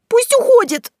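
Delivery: high, loud panicked shriek; frantic scream; yelling. A narrator's reading. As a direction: squeal it out, desperate to be left alone. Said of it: "завопила Василиса".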